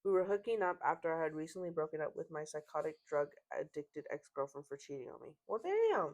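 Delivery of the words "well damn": high-pitched surprised reaction; impressed, shocked little exclamation; taken aback. Real, not acted. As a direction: jump up in pitch, startled by what you read. Reacting to the story being read.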